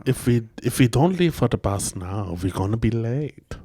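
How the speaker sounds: measured, hard-edged German accent. Shown as a demonstration. Demonstrating the bus line for a friend, German accent. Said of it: "That's like Nazi".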